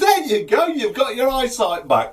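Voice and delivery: high, loud jubilant gotcha; triumphant announcement. Acted. Healer declares the patient's sight restored, high triumph. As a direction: crow as the trap springs.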